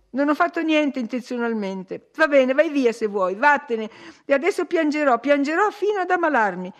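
loud, high, petulant tantrum; shouted outburst. A narrator's reading. Catherine, accused of lying, cries out petulantly at Edgar. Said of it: "gridò lei ritrovando la voce".

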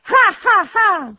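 loud, high ha-ha-ha; hearty laugh. A narrator's reading. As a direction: let out a big theatrical laugh. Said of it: "rideva il nipote di Scrooge".

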